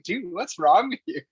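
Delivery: high-pitched imitation of a baffled friend; retold concerned question. Coach asks him as he tears up, imitated concern. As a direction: act out a friend's startled check-in.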